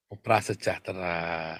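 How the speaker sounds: soft, low and drawn-out, mocking polite wording; slow, hushed mock politeness; mock euphemism. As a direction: imitate someone delicately softening the word.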